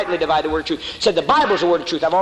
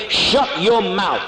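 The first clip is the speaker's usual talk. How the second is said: slow emphatic punchline; 4 semitones higher, much slower than usual. Real, not acted. Punchline to women.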